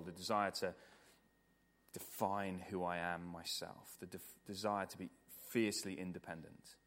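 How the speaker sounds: low, measured, earnest prayer; subdued, reverent praying voice. Real, not acted. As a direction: pray aloud for the congregation, with long pauses. Leads closing prayer.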